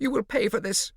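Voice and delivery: put-on high, loud threatening girl; shrill Morosina. A narrator's reading. Morosina threatening the snooper, high and loud. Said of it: "Morosina's shrill voice".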